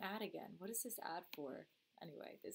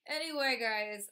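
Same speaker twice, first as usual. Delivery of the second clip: much higher, louder anyway, calling out; loud restart; 4 semitones higher, 10 dB louder than usual. Real, not acted. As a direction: shout Anyway to grab attention again. Restarting the sign-off loudly.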